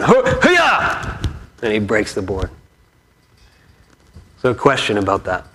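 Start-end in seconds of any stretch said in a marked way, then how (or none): 0.0-1.2 high triumphant hiya; karate kiai. Retold or imitated. Boy's winning strike, a high sharp kiai yell. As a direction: shout hiya as the board breaks. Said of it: "first punch, left hand".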